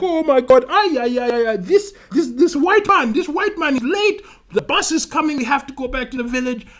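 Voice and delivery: high, agitated wailing complaint; mock crying. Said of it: "all of them crying".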